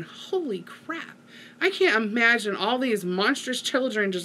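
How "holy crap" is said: awed under the breath. Retold or imitated. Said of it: "I'm going".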